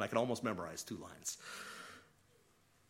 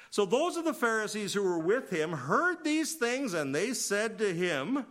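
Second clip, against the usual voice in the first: preaching; 8 semitones higher, 5 dB louder, slower than usual. Real, not acted. Preacher reads Pharisees' reply.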